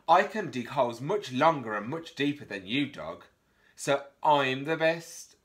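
bragging mole. Acted. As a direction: rub it in Dog's face. Mole brags at Dog.

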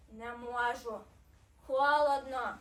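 low, reluctant hedgehog voice; low storybook character reply; little hedgehog. A narrator's reading. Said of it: "відповів їжачок".

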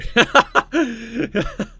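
loud bursting laugh. Real, not acted. Laughs at framed picture.

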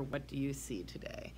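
hushed whispered title. Acted. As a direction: whisper it like a secret to the kids.

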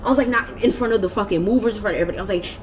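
normal talking voice

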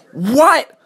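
high-pitched, indignant burst; furious snap. A narrator's reading. Rodney hearing he needed his own caboose, snapping furiously. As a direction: snap it with sudden outrage. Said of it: "snapped Rodney. He was furious".

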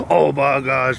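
ordinary talk

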